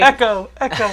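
high, loud call into emptiness. Pretend. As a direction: shout it out like into a void. Calling into empty game world.

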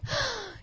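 quick shocked gasp, high and breathy; sharp gasp. Real, not acted. Reacting to Ted calling him son, a sharp shocked gasp.

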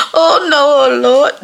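high, loud comic dismay; mock horror. Granddaughter realizing nana heard the wrong episode, mock horror. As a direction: wail it like you've been busted. Reliving being caught by nana.

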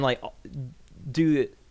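normal talking voice

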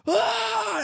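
high, loud mock scream; imitated pained scream. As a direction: scream like a girl hit in the eye. Imitates the girl hit in the eye.

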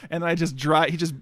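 ordinary talk, nothing marked

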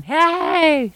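a high, loud holler. Acted. Mother shouting after the fish, a loud high holler. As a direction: call out sharply to stop the thief. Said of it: "she hollered at him".

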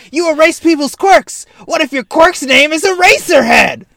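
a loud radio-host voice; Present Mike. Retold or imitated. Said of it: "Present Mike, like".

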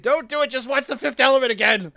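high, laughing melodramatic plea; overacted plea. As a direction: beg dramatically through a laugh. Mock dramatic plea, laughing.

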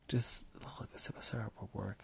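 soft unvoiced whisper. Real, not acted. Muses to herself about the recipe in a whisper. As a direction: whisper it to yourself.

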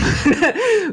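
loud, high burst of laughter; a sudden high laugh at the joke. Real, not acted. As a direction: break into a bright laugh. Laughs at her own impression.

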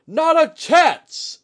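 high, slow, very loud defiant bark. Acted. Commander charging at the undead, barking. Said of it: "Etsuji barked".

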